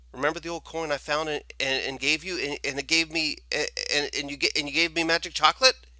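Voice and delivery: fast, high, loud, tripping over words; panicked boy stammering at the shopkeeper; frantic stammering demand. Acted. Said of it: "he demanded".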